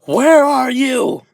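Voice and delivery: loud, high, drawn-out roar; enraged monster yell. Acted. Dark Shadow hunts unseen Hagakure, enraged yell. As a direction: bellow it slowly, searching for the attacker. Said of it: "As Dark Shadow says".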